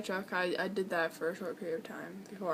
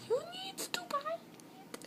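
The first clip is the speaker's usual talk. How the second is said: high, slow mocking squeak; mock high-pitched demand; 8 dB quieter, slower than usual. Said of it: "Now it's like".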